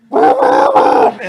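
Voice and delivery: screaming at full volume; sudden scream. Retold or imitated. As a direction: blurt it out in a scream. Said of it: "scream full volume without warning".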